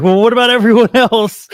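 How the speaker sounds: high, loud, laughing through the question; giddy laughing protest. Retold or imitated. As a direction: crack up while asking about the others. Retold cheeky question to guard.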